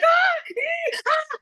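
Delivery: high-pitched playful shrieks, acted out; mock roller-coaster screams. Telling a brother about riding a roller coaster, mock screaming. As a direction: squeal like a rider on a coaster. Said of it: "screaming the whole time".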